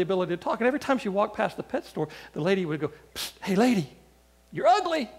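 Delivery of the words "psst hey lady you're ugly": talking bird taunting a passerby, rising sharply at the end; heckling bird. Acted. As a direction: squawk a taunt, jumping high on the insult.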